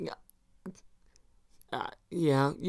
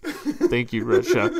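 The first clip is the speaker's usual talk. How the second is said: imitating back-room voice; 3 semitones lower, 7 dB louder than usual. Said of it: "and you're like".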